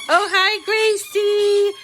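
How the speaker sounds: high, slow, sing-song greeting to the dog; greeting the dog Gracie in a high, drawn-out pet voice. Real, not acted. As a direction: coo a hello to the dog.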